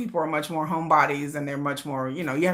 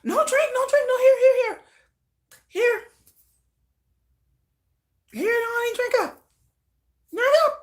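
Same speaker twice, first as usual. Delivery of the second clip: high mock drunk refusals. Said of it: "I'll be like".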